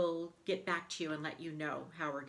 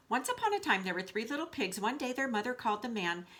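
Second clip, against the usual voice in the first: rushed reading; 5 semitones higher, faster than usual. Acted. Wolf reads without stopping now.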